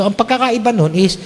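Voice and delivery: ordinary talk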